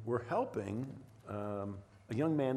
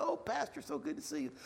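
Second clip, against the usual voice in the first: higher, lightly voiced woman greeting; a friendly wife at the door, pitched up; wife's greeting.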